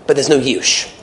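ordinary talk, nothing marked

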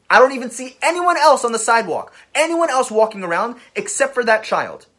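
loud, high alarm, hammering each phrase; urgent retelling. Retold or imitated. Storyteller stressing that the toddler is all alone, urgent. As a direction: raise the voice sharply as the danger grows. Urgent peak of retold danger.

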